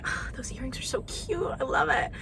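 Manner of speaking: a retold gush, breathed out as a whisper; whispered compliment. Vlogger retelling her compliment to Lee: excited whisper. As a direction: hush it, high and breathy with delight.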